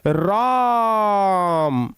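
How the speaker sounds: राम with the aa stretched slowly; drawn-out Ram. Shown as a demonstration. Teacher shows pluta vowel on राम. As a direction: pull the vowel of राम long. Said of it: "हम बोलते हैं ऐसे".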